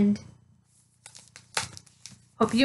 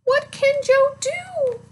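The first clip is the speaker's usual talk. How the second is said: much higher, slow asking question; curious question; 11 semitones higher, slower than usual. Acted. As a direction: ask the kids, high and drawn out. Asks the children.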